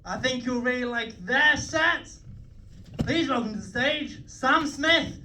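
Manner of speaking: high, drawn-out MC announcement; stage introduction. Acted. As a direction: build up to the act's name, pitched high and loud.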